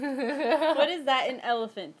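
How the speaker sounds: laughing loudly, teasing repeat. Retold or imitated. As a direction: laugh through the words. Repeats partner's odd phrase laughing.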